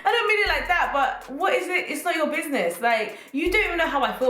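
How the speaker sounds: high-pitched, amused indignation; laughing protest. Real, not acted. Protesting at nosy church aunties, laughing indignation. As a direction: argue back through leftover laughter.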